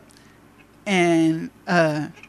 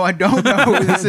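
loud, higher, laugh-tinged protest; amused exasperation; 4 semitones higher, 8 dB louder than usual. Retold or imitated. Pharmacy worker to unknown caller, laughingly exasperated. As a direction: protest in mock bafflement. Baffled reply to caller, retold.

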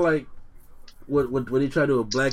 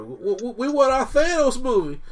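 high whiny fan voice, mocking; pleading kid demanding a movie; 10 semitones higher, 3 dB louder than usual. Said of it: "here goes somebody".

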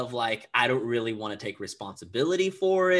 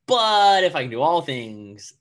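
emphatic self-rebuttal; 3 semitones higher, 11 dB louder than usual. Retold or imitated. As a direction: counter the doubt forcefully, much louder. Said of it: "I'd be like".

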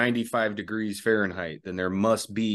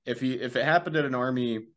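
ordinary talk

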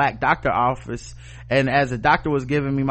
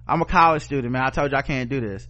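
ordinary delivery

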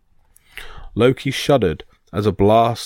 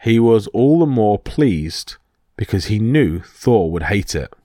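ordinary delivery

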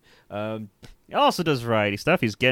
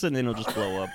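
ordinary talk, nothing marked

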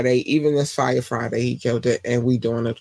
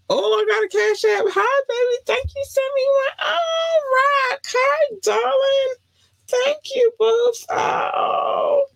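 squeaky high thank-you; thrilled high-pitched gushing; high delighted squeal; slower than usual. Real, not acted. Squealing thanks for Cash App gift.

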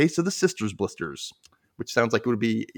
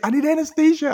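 put-on much higher, louder little-kid voice; kid asking; 6 dB louder than usual. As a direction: plead like a small boy at the dentist. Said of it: "he was like".

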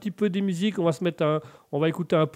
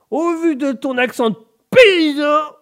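loud, high mock-American French; slow American holler, last word stretched; McDonus; 11 semitones higher, 10 dB louder than usual. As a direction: blare it out in a hammed-up American accent. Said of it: "aidez-moi, mon cher McDonus".

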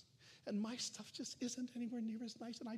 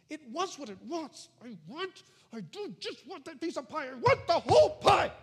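rising whine up to a loud grab for the whole pie; the flesh demanding pie like a spoilt child; whiny craving flesh; 6 semitones higher, 11 dB louder than usual. Acted. Preacher voicing the flesh as a greedy child, whining.

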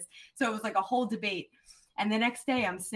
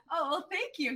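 giddy thanks; 9 semitones higher than usual. Retold or imitated. Thanking coach, still giddy.

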